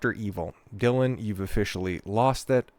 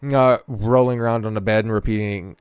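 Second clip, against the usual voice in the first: ordinary talk, nothing marked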